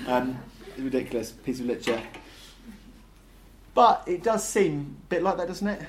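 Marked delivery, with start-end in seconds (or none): none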